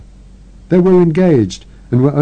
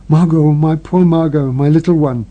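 weeping lament; 3 semitones higher than usual. Acted. Sister at the deathbed, weeping over Margot. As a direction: grieve over the dying sister. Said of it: "wept wildly".

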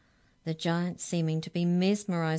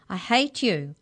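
higher, loud outburst; angry shout; 4 semitones higher, 6 dB louder than usual. A narrator's reading. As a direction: shout it with hatred. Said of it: "she shouted".

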